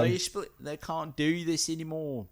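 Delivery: put-on deadpan Kiwi accent headline. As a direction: Kiwi accent, light and sarcastic. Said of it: "that actually wasn't that bad".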